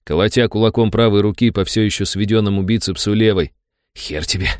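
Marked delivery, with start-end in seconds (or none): 4.0-4.5 soft shaky defiance; trembling whisper. A narrator's reading. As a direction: spit it out under the breath. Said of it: "прошептал я трясущимися губами". (дрожащий шёпот)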